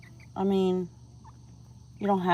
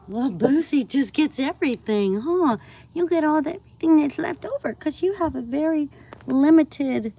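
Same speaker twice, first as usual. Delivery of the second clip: high, loud coo to a pet; excited pet talk; 8 semitones higher, 11 dB louder than usual. Real, not acted. Owner gushing to her pet, high and loud. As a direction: gush over the little one, high and loud.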